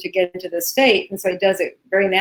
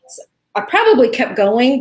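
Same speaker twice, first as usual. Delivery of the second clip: normal talking voice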